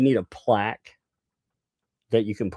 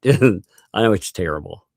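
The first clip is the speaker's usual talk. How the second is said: usual speaking voice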